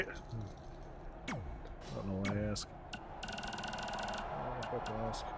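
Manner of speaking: low, slow mutter under his breath; quiet muttering. Real, not acted. Frustrated gamer grumbling to himself, quiet mutter. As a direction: grumble it to yourself, barely audible.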